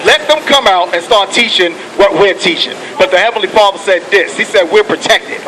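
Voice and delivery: normal talking voice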